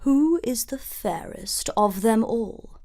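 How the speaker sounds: lightly voiced vain queen calling to her mirror; a hint of a haughty queen; queen asks the mirror. A narrator's reading. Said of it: "she asked".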